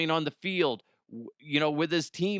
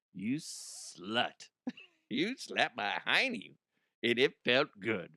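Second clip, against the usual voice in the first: much softer, lower, teasing voice; mock sultry; 3 semitones lower, 4 dB quieter, slower than usual. Podcaster teasing the listener who slapped him, mock sultry. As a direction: purr it like a flirt. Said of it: "mock flirty line to listener".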